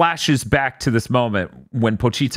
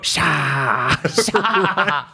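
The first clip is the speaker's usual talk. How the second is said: drawn-out "sha" sound effect; mock attack cry. Imagining Excel sneaking up on someone, mock attack cry. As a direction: pounce with a playful "sha". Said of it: "sneak up on someone and go".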